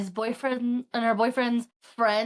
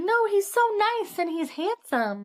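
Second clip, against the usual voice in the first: smitten gushing; 8 semitones higher than usual. Retold or imitated. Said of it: "and she's like".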